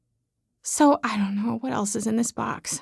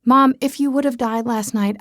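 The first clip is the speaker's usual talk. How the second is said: plain reading voice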